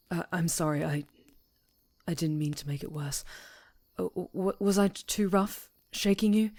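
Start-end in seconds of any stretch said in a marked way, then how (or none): 4.0-6.5 flustered stammer. Acted. Flustered apology after waking her.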